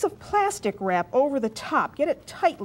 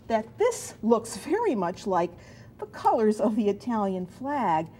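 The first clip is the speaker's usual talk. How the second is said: ordinary talk, nothing marked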